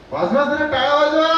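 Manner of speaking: high, rising, loud rally; calling for applause. Real, not acted. Host gets the audience clapping for the couple, rallying shout. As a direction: push the crowd to clap.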